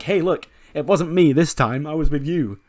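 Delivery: hammed-up indignant denial; mock protest. Voicing Breeze denying the attack.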